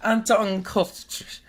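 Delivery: mock German reading. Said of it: "in, in German".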